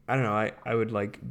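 ordinary talk

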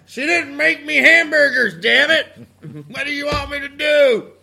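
high, loud whining shriek; shrieking husband. Acted. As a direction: screech the excuse. Voicing the murdering husband.

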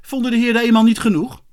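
loud, high, sarcastic reprimand; cutting mock-polite question; unfriendly. A narrator's reading. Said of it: "helemaal niet vriendelijk".

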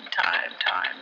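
time, time breathed as a low whisper; whispered echo. Acted. Radio host musing about time to listeners, whispered echo. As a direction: whisper the words like an echo fading.

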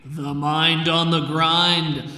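loud, slow arena introduction; fighting game announcer. Acted. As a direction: build up the fighter's name.

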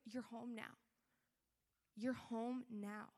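soft, unhurried welcome; tender reassurance. Acted. Loving father receives a returning child, tender and soft. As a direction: gently settle them with each word.

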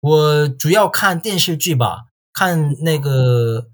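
normal talking voice

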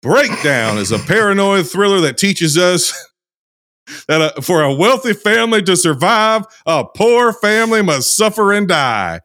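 put-on lively Southern-accented storyteller reading an intro. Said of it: "intro here in this accent".

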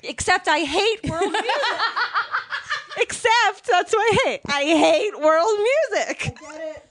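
high, loud, laughing through words. Real, not acted. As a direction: giggle out the confession. Laughing confession.

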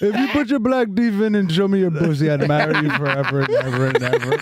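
hammed-up Indian accent, pleading suitor; mock Indian accent. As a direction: sweet-talk her in a comic Indian accent. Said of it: "That's very good".

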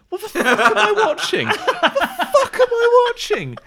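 high, loud incredulous cry through laughter; exasperated outburst. Retold or imitated. Viewer reacting to an absurd luxury flight video, exasperated high yell. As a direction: shriek it in laughing disbelief.

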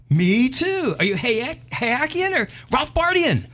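woman's voice far up, eager; high eager woman. Acted. Woman on bridge quizzes the man, high and eager. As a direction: quiz him eagerly in a high voice.

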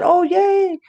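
high-pitched delighted little cheer; excited child. Retold or imitated. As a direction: act out a kid's big yay. Retold child's delight at surprise.

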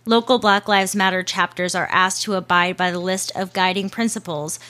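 ordinary talk

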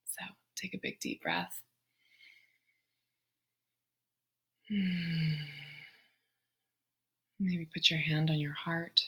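soft, unhurried meditation voice with long pauses; guided meditation. Real, not acted. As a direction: guide them gently into the breath, hushed and spacious. Leads a breathing meditation.

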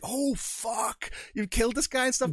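high, startled cry of dismay; shocked. Retold or imitated. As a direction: react like the twist just hit you. Retelling own shocked reaction.

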